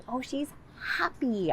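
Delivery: cheerful storytime voice; happy exaggerated. Shown as a demonstration. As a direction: show the child she's happy. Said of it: "So I'd say".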